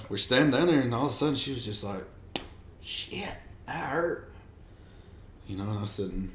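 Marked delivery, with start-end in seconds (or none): 2.8-4.3 high, breathless mock cry of pain; pained yelp. Wife stung by a yellow jacket, high pained yelp. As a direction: squeak it out like a sudden sting.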